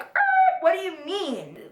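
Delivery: high squeal into an indignant question; hammed-up high-pitched disbelief; incredulous. Re-enacts shock at lost scholarship.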